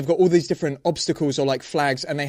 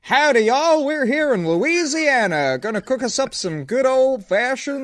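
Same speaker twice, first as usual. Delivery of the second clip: high, hammed-up Southern drawl; mock Louisiana cook, way up high; southern US accent; 9 semitones higher, 3 dB louder than usual. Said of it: "do your best Japanese accent".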